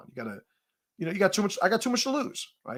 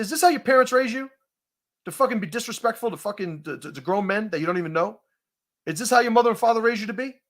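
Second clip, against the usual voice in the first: fast high scolding; 6 semitones higher, faster than usual. Retold or imitated. Scolding the sixteen-year-old.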